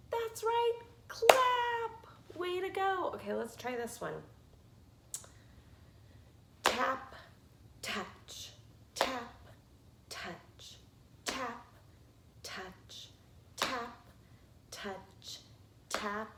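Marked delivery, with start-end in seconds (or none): 0.1-3.1 higher, delighted gasp into the answer; excited praise. Acted. Teacher cheers a preschooler's correct answer, high and delighted. 7.8-13.9 each touch breathed out as a soft whisper. Shown as a demonstration. Teacher whispers the touch in the pattern for the children, softly. 14.7-15.4 "touch" breathed out as a soft whisper. Shown as a demonstration. Teacher whispers "touch" in the tap-touch pattern. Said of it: "What comes next?".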